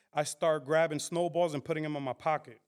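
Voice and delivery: ordinary delivery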